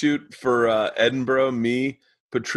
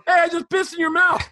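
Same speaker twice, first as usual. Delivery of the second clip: much higher, shouted cheery stranger voice; passer-by at the urinal, mock shout; random stranger shouting; 3 dB louder than usual. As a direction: yell it like a happy drunk bloke in the bar.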